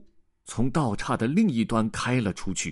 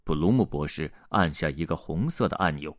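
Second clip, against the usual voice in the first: usual speaking voice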